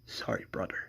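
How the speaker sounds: whispered last words; dying Felipe whispering goodbye to his brother. Acted. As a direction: breathe it out as a farewell.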